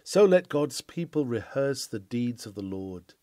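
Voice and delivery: usual speaking voice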